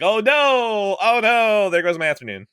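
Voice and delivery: high, loud hammed-up panic; mock dismay. Viewer losing the afternoon, loud hammed-up dismay. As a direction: cry out in fake alarm.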